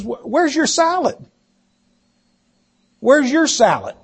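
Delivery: imitating a husband's innocent question, pitched high; high-pitched, mildly puzzled mock husband; innocent inquiring. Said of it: "innocently, he, he just was wa-, inquiring".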